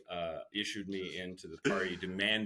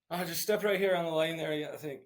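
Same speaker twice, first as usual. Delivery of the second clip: higher, light Irish lilt; Irish accent; 9 semitones higher, 4 dB louder, faster than usual. Retold or imitated. Greeting families at the Skyflyer ride in an Irish accent. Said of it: "enough of an Irish accent".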